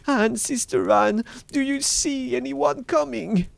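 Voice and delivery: high, loud call for help; desperate cry. A narrator's reading. Wife calling to Sister Anne on the tower, desperate high cry. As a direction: cry out urgently to the lookout. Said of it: "and then she cried".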